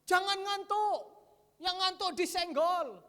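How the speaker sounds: high, loud stern call to stay awake; pastor's warning shout. Retold or imitated. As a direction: bellow it like the pastor rousing sleepers. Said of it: "Bapak gembala tekankan".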